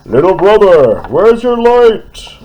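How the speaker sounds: high, loud, slow calling; bridge calling. Acted. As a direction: call out across the river. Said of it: "the great gray bridge called".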